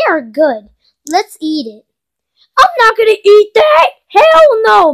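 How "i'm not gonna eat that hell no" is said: high, loud protest; indignant shouting; outraged refusal. Acted. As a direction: flatly refuse the food at a yell. Refusing disgusting food.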